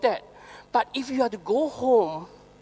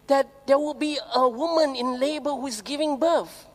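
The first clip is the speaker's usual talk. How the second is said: ordinary delivery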